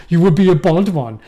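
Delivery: loud mock-German pronouncement; imagined German dentist. German dad to little boy, loud mock pronouncement. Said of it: "He's a German dentist".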